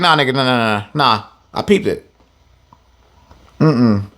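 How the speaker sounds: low, knowing brush-off; dismissive refusal. Retold or imitated. Ezra brushing off the brother, low and knowing. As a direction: shut him down, you saw through it.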